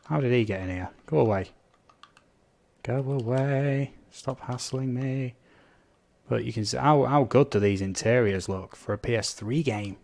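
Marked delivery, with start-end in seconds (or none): 2.8-5.3 slow, drawn-out whine, pitched up; mock whiny plea. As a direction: whinge at the zombie like a pestered kid. Zombie pestering the player.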